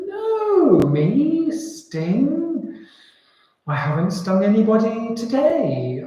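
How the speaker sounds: high, swooping, incredulous denial; mock innocence. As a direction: play the scorpion shocked at the very idea. Scorpion acts innocent to frog.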